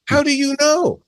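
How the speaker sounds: high, disbelieving how do you know; a mock-outraged squeak of a question; incredulous challenge. Acted argument with a critic.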